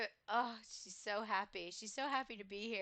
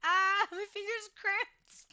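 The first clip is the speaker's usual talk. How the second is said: louder, slower, higher pained complaint; real pain from a cramped finger, louder and slower; pained cry; 7 semitones higher, 8 dB louder than usual. Real, not acted.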